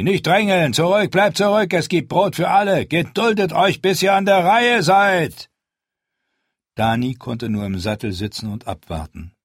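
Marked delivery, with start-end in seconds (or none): 0.0-5.5 loud, high crowd-control shouts; shouting orders. Acted. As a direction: hold the desperate crowd back with your voice. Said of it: "riefen sie".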